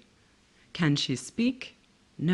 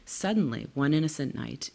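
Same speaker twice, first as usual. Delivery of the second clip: usual speaking voice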